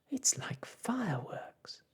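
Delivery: put-on high, slow boyish whisper; little boy voice. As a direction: whisper like an awed small boy.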